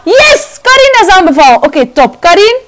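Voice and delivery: gasp, then a high, loud, thrilled yes; excited yes. Real, not acted. Gamer cheering as her sim goes into labour. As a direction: burst out with joy at the news. Baby being born in game.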